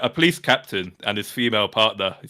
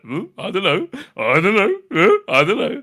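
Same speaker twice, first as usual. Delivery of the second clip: clueless mumble; 7 semitones higher, 3 dB louder than usual. Retold or imitated. Clueless officers shrugging.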